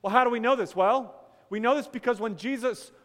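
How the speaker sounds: loud, emphatic sermon voice; full pulpit voice; preaching at full force. Acted. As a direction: press the point from the pulpit.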